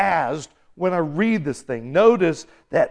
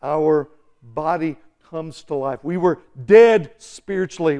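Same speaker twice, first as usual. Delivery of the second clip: ordinary delivery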